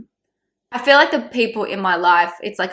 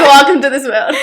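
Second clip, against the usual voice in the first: mock welcome; 6 semitones higher, 10 dB louder than usual. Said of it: "You're like".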